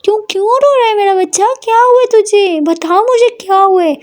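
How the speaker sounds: high, alarmed mother pleading for an answer; startled by her daughter's tears; shocked worried mother. A narrator's reading. Mother sees her daughter crying, high and alarmed. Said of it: "हैरान हो जाती है और बोलती है".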